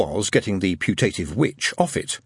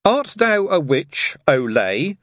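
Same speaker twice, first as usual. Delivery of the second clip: high, slow inquisitorial challenge; mock-solemn interrogation, pitched high; chief inquisitor; 7 semitones higher, 4 dB louder, much slower than usual. A narrator's reading. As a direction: pronounce it like a child playing grand inquisitor. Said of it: "said the chief inquisitor".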